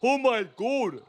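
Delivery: slow, drawn-out, high "Oh my God" in a mock Bahamian accent. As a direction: stretch it out like VJ's accent. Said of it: "Vous avez vu son accent à V J".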